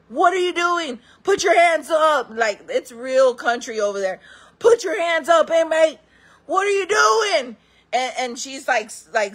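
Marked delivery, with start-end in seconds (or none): 0.1-2.3 guard yelling. Retold or imitated. Guard yelling at inmate, shouted command. 4.6-7.6 high slow shouted order; guard yelling. Retold or imitated.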